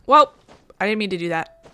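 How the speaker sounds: normal talking voice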